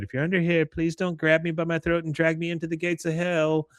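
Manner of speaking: high-pitched, timid searching call; nervous girl calling. Retold or imitated. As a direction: mimic a girl calling under a bed. Voices girl pleading under bed.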